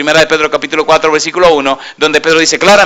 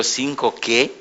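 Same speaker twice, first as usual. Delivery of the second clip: quiet disbelief; 9 dB quieter, slower than usual. Retold or imitated.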